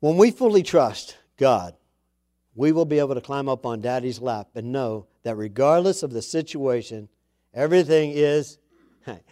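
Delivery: ordinary delivery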